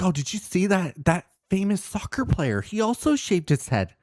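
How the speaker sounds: high, excited mom voice, lightly done; mock his mom, pitch up; imitating mom. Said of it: "She's like".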